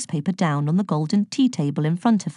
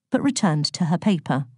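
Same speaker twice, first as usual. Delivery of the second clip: ordinary delivery, nothing marked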